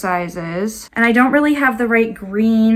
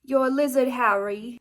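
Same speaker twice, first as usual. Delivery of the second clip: put-on failed British accent, slightly high; mock British Harry Potter line; bad British accent; 3 semitones higher than usual. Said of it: "I can't do a British accent".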